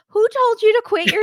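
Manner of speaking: high, loud, incredulous yell; mock outrage. Host scolds her for quitting, high mock outrage. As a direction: shoot the pitch way up in disbelief.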